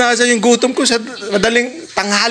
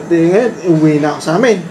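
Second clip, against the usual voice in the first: normal talking voice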